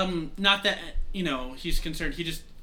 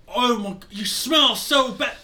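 high, loud, drawn-out revulsion; disgusted recoil; 8 semitones higher, 8 dB louder, slower than usual. Acted. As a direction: recoil loudly from a stinking hug. Character recoils from a smelly bear hug.

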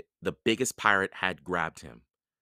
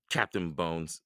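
ordinary delivery, nothing marked

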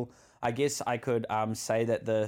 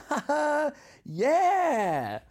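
imitating a drunk mate's whoop, much higher, louder on yeah; hooting laugh; 5 dB louder, much slower than usual. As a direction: whoop like a teen having a blast. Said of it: "it was just a hoot".

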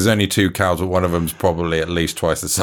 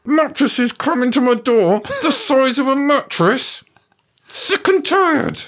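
very high squeaky rant; slower than usual. Acted. Outtake silly high voice.

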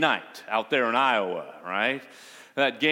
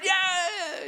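much higher, a mock stadium cheer; crowd cheer. The preacher imitates the fans' answer, a high mock cheer. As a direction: whoop like the whole crowd.

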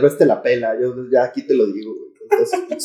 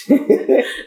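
unvoiced laugh; 12 semitones higher than usual. Real, not acted. Laughs after joke.

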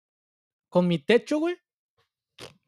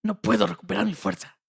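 Goku impression. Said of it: "Como Goku, güey".

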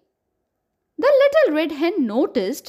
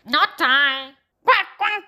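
put-on duck voice with short quacks; quacking duck; slower than usual. A narrator's reading. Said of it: "quacked the noisy yellow duck".

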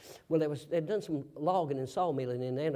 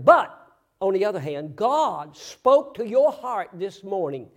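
fiery preaching; 10 dB louder than usual. Acted. Preacher urging the congregation about hell, preaching loudly. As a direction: preach it hard and driving.

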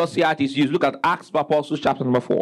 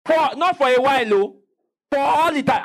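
higher and louder, driving it home; emphatic; 10 semitones higher, 3 dB louder than usual. Acted. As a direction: hammer the point about forever. Preacher stressing eternity.

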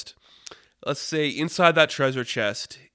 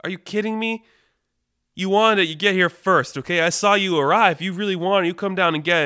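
higher, louder mock outrage; high, loud, indignant telling-off; 7 semitones higher, 6 dB louder than usual. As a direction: argue back in acted disbelief. Acted quarrel over the golden loop.

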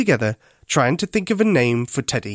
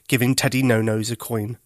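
usual speaking voice

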